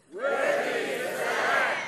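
slow, high, full-voiced shout of praise; loud shout. Shown as a demonstration. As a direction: shout it out like heaven's choir. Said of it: "let's say it in a loud voice".

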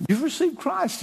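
high, gushing mock congratulation; well-meaning church person. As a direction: imitate an over-keen helper congratulating someone. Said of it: "some well-meaning person can come up".

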